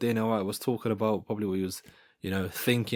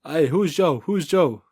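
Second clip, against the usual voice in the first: mock little fighter, higher and louder; Topuria imitation; 5 semitones higher, 5 dB louder, slower than usual. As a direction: pipe up high in a teasing imitation. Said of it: "this little Spaniard".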